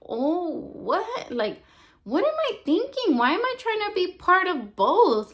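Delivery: stunned realization. Retold or imitated. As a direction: reenact a sudden wake-up in disbelief. Acting out her sudden realization.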